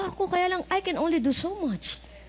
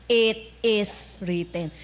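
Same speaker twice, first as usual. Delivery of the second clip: slow, lower, solemn; slow solemn quote; 6 semitones lower, much slower than usual. Acted. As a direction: say it with calm authority. Jesus quoting scripture. (mabagal at taimtim na sagot ni Jesus)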